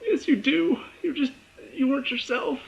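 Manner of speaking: high, tearful-sounding reassurance; imitating a teary viewer talking back to the screen; tearing up. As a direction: plead it in a high pitch, as if choking up. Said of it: "tearing up too. I'm like".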